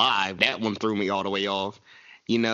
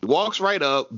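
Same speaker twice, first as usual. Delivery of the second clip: ordinary talk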